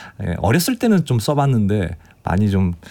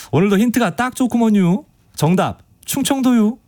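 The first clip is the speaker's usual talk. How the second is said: put-on higher, amateur Chungcheong accent read; 어설픈 충청도 사투리로; clumsy Chungcheong dialect; 10 semitones higher than usual. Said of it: "사투리 어설프게 써서".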